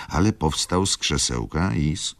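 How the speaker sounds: plain reading voice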